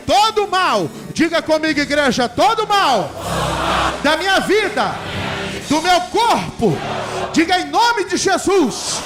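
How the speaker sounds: ordinary delivery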